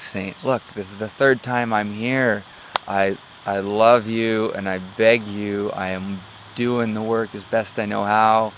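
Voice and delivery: hammed-up last-ditch appeal to a saint; desperate pleading. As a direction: plead slowly and emphatically, acting at wit's end. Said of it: "now he's really desperate".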